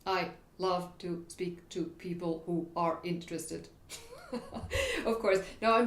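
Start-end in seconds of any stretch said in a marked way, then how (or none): 0.1-3.7 every word cut apart with a gap; exaggerated staccato. Shown as a demonstration. Coach playfully overdoing a pushy delivery, exaggerated staccato. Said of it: "it's a bit, uh, exaggerated". 3.9-5.0 short high-pitched giggle; laugh. Real, not acted. Coach amused at own exaggerated demo, laughing.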